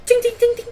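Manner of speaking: high loud ting-ting imitation; metal clatter sound. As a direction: chime out each ting up high. GM imitates glaive hitting ground.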